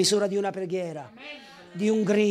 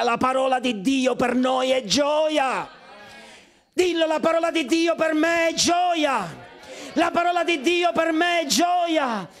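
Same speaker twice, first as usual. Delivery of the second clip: preaching at full force; 7 semitones higher than usual. Acted. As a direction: hammer the faith home. Preacher proclaims joy and courage.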